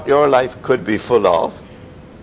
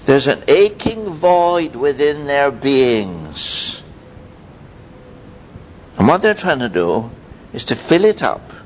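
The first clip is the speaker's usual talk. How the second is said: ordinary delivery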